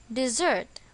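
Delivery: "dessert" with the stress on its second syllable, di-ZERT. Shown as a demonstration. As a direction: lean on the end of "dessert", di-ZERT. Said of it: "Same with the noun dessert".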